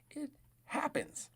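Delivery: high, slow sing-song; drawn-out teasing sympathy; slow mock consoling voice. Repeated consoling phrase.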